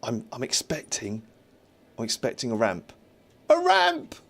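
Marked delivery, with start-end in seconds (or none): 3.5-4.2 a sudden very high, loud squeal of delight; triumphant high-pitched cry; excited squeal. Real, not acted. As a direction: shriek it out in glee. Spotting the expected ramp.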